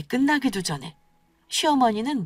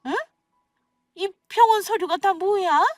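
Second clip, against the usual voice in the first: higher-pitched, suspicious mother-in-law; lightly voiced mother-in-law, questioning; 11 semitones higher than usual. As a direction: confront her over what you found. (추궁하는 시어머니 목소리)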